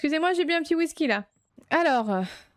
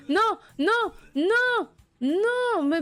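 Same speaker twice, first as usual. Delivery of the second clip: high, whining non non non; pleading with the game to stop; dismayed protest; 7 semitones higher, much slower than usual. Real, not acted. Gamer protesting at a vomiting customer, dismayed high whine.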